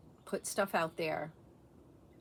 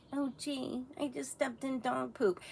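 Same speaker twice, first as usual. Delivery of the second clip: prissy polite person; 4 semitones higher, 4 dB quieter than usual. Retold or imitated. Mocking prim polite speaker.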